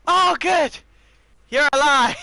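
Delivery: loud, high, hammed-up relief; exaggerated relief. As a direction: overplay the relief for laughs. Mocking echo of the game line.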